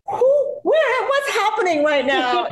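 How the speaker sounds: high, slow, laughing disbelief; overwhelmed disbelief. Retold or imitated. Fan overwhelmed by a star's praise, laughing in disbelief. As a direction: laugh in stunned confusion.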